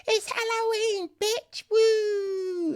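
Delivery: very high imitation party girl; hammed-up excited squeal; mock party squeal. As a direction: whoop it in a silly high voice. Mocking the friends' party line.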